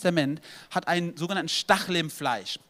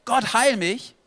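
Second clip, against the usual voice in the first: louder and higher plea; louder, higher plea to be healed; crying out to God; 6 semitones higher, 7 dB louder, slower than usual. Retold or imitated. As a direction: call out to God for healing. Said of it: "und du schreist zu Gott".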